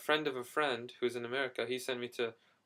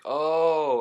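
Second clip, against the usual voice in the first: loud, higher drawn-out "oh" of recognition; dawning realization; 5 semitones higher, 13 dB louder than usual. Retold or imitated. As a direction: act out the moment it suddenly clicks. Retold reaction of sudden understanding.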